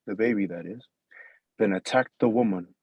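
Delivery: usual speaking voice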